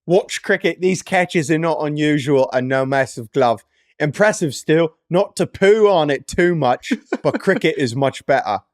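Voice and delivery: hammed-up British accent; amateur put-on British accent. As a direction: read it like a British cricket fan. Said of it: "in your best British accent".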